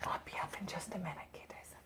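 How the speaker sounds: quick unvoiced whisper aside. Real, not acted. Reader whispering to children in the next room. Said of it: "aside to kids offstage".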